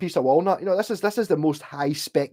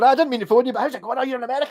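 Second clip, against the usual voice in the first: imitating pal Rab; 9 semitones higher, much faster than usual. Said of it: "He was just in the shop going".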